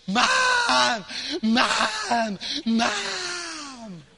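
mock child yelling mom, pitch breaking; child screaming for mom. Kid in the grocery store screams for his mother, imitated. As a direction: wail for mom like a toddler. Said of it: "Kid, top of his lungs".

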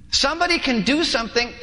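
ordinary talk

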